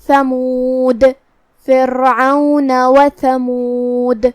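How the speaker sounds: ثمود ending with a bounced dal; qalqala on dal. Shown as a demonstration. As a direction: let the dal of ثمود echo. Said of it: "دال کو ہلاتے ہوئے".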